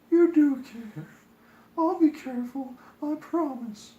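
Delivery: high, sweet promising princess; high tender reassuring princess. Acted.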